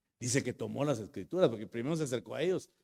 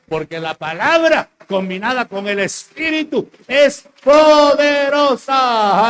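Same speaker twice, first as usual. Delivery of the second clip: very high, loud, stretched climax; full-force preaching; 12 semitones higher, 17 dB louder, slower than usual. Acted. Preacher exalting the power of the Word, very loud and high. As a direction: roar the word poderosa.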